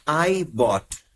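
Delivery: normal talking voice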